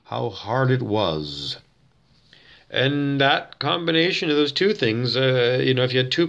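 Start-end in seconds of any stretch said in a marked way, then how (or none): 0.1-1.6 slow, low and soft verse ending; preacher reading. Shown as a demonstration. A hint of Lovejoy ending the verse slowly. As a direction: let the line sink slowly. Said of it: "Reverend Lovejoy says".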